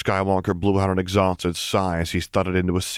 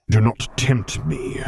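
slow Vader threat; 6 semitones higher, slower than usual. Acted. Vader warning his defiant son, slow menace. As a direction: let each word land like a threat.

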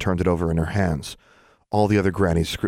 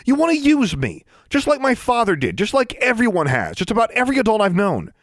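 angry; 5 dB louder than usual. A narrator's reading. Alexandra's angry outburst continues.

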